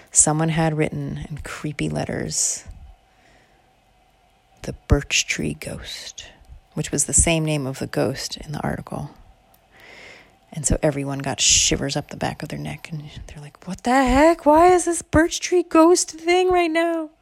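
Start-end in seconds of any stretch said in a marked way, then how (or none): 4.6-6.3 slow, hushed ghost-story whisper; eerie, drawn-out whisper; spooky reading. Acted. Reading creepy graffiti aloud. 13.7-17.1 high, spooked whine; rising, drawn-out disbelief in a scared high voice; acted creeped-out alarm; frightened reaction. Scared friends react to ghost graffiti.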